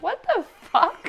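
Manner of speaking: high, squeaky retold "what the fuck"; shocked outburst. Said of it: "I was like".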